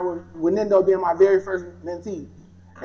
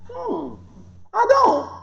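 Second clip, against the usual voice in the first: coy high hmm; 14 semitones higher, much slower than usual. Retold or imitated. Quoted reply to God.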